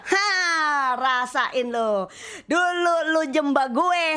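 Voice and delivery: slow, sneering devil taunt; mocking devil. The devil taunting her thoughts, slow and sneering. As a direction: gloat over her like the devil. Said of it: "iblis mulai nyerang pikiran saya".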